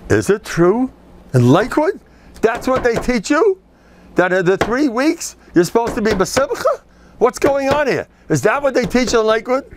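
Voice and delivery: high, pressing indignant questions; outraged caller. Retold or imitated. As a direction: demand answers, scandalized. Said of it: "Big enough".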